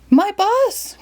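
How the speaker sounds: very high alarmed cry; driver cries out. Acted. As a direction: cry out in dismay. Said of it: "cried the driver".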